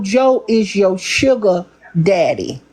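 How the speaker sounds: usual speaking voice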